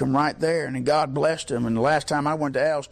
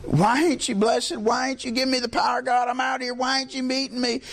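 imitating a crying man's raised, pleading protest to God; tearful complaint; 6 semitones higher than usual. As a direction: cry out higher, as if demanding an answer. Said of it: "he was crying and said".

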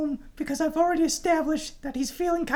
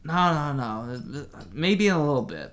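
low, deep male voice on radio; Fredericks voice; 10 semitones lower than usual. Acted. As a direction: drop low as Fredericks on walkie talkie. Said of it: "I have his voice".

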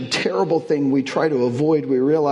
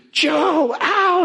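high, louder fake yelp of pain; retold scream; 14 semitones higher, 3 dB louder than usual. Sister framing her brother in the hallway, with a high mock scream. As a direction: mimic a little sister faking hurt. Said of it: "and then screamed".

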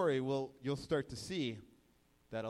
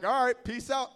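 breezy farewell; 8 semitones higher, 10 dB louder than usual. Retold or imitated. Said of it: "he's like".

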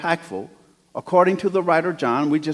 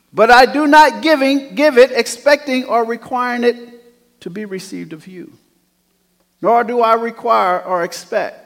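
rising, louder preacher's cadence; full-force preaching; 8 semitones higher, 6 dB louder than usual. Acted. Pastor preaching to the congregation, full force.

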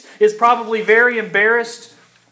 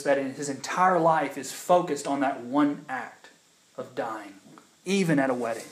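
hushed solemn; 5 semitones lower, 6 dB quieter than usual. Real, not acted.

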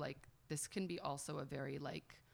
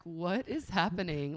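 loud, slow, drawn-out disbelief; mock bewilderment; 3 semitones higher, 7 dB louder than usual. Telling friends about turning thirty, loudly bewildered. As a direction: stretch it out in baffled emphasis. Bewildered at her changed hormones.

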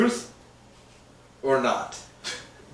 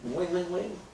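soft mock phone ringing; 3 semitones higher, 13 dB quieter than usual.

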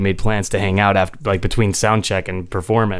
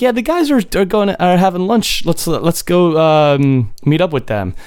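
quoting a friend; 8 semitones higher than usual. Retold or imitated. Drummer quoted inviting to lunch.

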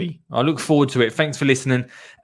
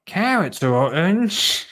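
put-on higher, slow child-character line; a hint of a child working it out; lightly voiced Dottie character; 6 semitones higher than usual. Dottie answers Lottie about carrots, lightly voiced higher child. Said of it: "said Dottie".

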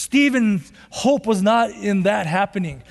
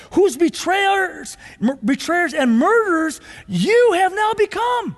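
building to a shout; 7 semitones higher than usual. Acted. Reading Stephen's climax.

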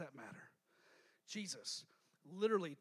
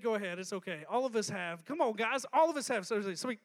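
normal talking voice

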